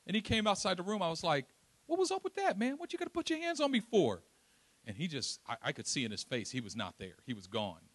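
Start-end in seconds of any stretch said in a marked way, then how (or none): 1.8-4.2 high, heated challenge to the man who shoved him; angry confrontation. Retold or imitated. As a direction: square up and demand answers, voice up high.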